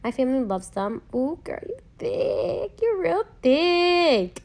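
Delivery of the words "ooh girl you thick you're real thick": high, very slow, drawn-out admiring voice; a playful put-on voice, much higher and slower than her own; imagined husband's compliment. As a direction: pretend to be the husband, savouring each word. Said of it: "Waiting on my husband to say that".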